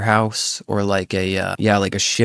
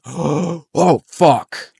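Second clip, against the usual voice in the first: high, loud shocked oath; horrified curse; 7 semitones higher, 4 dB louder than usual. Retold or imitated. Friend sees the arm in the light, horrified. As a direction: recoil in horror at the arm.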